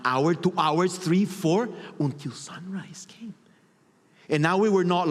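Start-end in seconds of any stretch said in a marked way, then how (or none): 2.0-3.3 an unvoiced, slower whisper. Real, not acted. Describing the dawn arriving, whispered. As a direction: let it trail off in a whisper. Story reaching its end.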